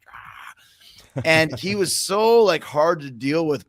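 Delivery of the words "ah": breathy whispered ah. Retold or imitated. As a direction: exhale a soft ah. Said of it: "just going like".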